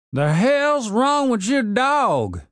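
put-on high, slow grumpy old codger; cranky old man. A narrator's reading. Old man ordering his broken zombie gardener, gruff old voice.

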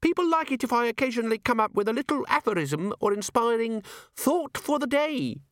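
very high, chirpy little device voice; tiny imp chiming. A narrator's reading. The pocket organiser imp announcing itself to Vimes, chirpy. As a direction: sing out the chime like a small gadget.

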